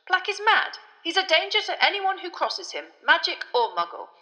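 high, loud, exasperated minister; irritable official. A narrator's reading. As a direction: protest tetchily, voice pitched well up. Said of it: "said an irritable Fudge".

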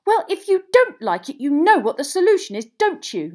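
high, bellowed accusation; furious yell. A narrator's reading. Hermione bellows at Ron in the common room, furious yell. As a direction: yell it across the room in a blazing row. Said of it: "yelled Hermione".

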